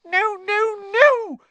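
very high, loud elf squeal; squeaking. A narrator's reading. Dobby shaking his head hard, loud frantic squeak. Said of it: "squeaked Dobby".

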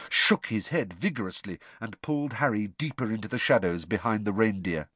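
ordinary delivery, nothing marked

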